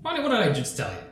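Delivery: high-pitched scolding, climbing on the repeat. Acted. Captor pulls hostage Bonnie back into line, scolding. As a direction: scold like a parent repeating a question.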